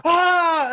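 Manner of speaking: high, loud re-enacted scream; long yell of overjoyed fandom, acted out; excited scream. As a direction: scream along with the cheering crowd. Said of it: "like, screaming at it".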